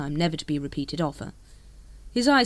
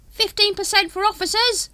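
high, loud, urgent sales pitch; urging hawker; 6 dB louder than usual. A narrator's reading. Dibbler calls after departing Vimes, high urgent pitch. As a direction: call after him to close the sale. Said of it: "urged Throat".

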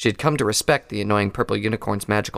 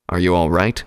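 concerned; 3 semitones lower, 5 dB louder than usual. A narrator's reading. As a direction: lower stallion voice, caring. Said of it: "genuine concern in his tone".

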